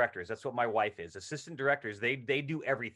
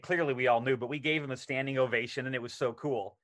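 ordinary talk

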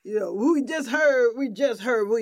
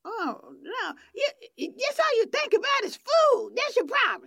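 high-pitched, put-on scolding; scolding proper-talker; 13 semitones higher than usual. Radio guest teasing co-host about food, mock scolding. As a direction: scold playfully in a high affected voice.

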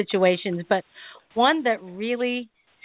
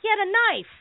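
ordinary talk

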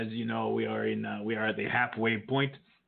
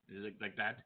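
ordinary delivery

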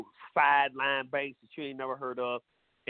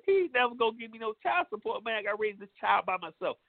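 higher-pitched mock complaint from a woman; mock woman complaining; 7 semitones higher than usual. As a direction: play a lady whining about her broke ex, pitched high. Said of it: "And saying".

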